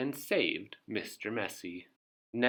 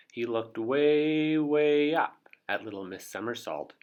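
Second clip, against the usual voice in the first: usual speaking voice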